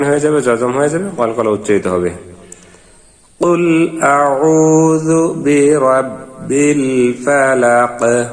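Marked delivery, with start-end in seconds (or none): 3.4-8.2 slow melodic tajweed chant; Quran recitation. Shown as a demonstration. Teacher chants the verse for students, slow and melodic. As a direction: draw each syllable out in a sung recitation.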